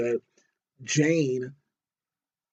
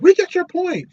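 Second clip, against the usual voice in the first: exasperated Rita; 11 dB louder than usual. Retold or imitated. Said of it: "Rita's kinda like".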